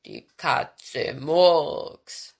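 low, very slow bear rumble; lumbering, humming bear; growling bear. A narrator's reading. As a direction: drag it out in a deep bear voice. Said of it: "brummte er".

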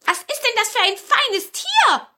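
high, loud hen voices; excited little hens marvelling; curious hens. A narrator's reading. Hens ask about the fine stranger, high and loud. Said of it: "fragten die Hühner".

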